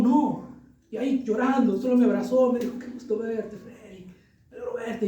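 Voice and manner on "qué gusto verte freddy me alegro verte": soft, tearful imitation of a friend; half-voice the crying friend, softly. Pastor retelling his weeping friend, soft and tearful. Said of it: "y llorando".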